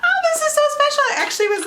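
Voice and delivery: excited. Real, not acted. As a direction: gush with delight. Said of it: "I'm freaking out".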